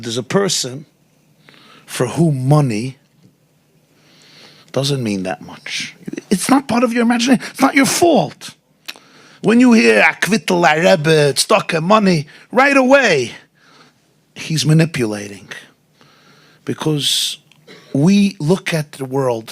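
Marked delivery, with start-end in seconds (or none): 6.3-13.3 high, loud, fast preaching burst; rising fervor. Real, not acted. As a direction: hammer the point home, climbing in pitch. Preacher confronting listener, rising intensity.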